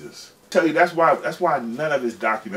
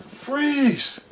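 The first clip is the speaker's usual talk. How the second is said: much higher mock police shout; police officer ordering them to freeze; cop yelling freeze. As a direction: bark it like a cop on a raid. Police bursting in.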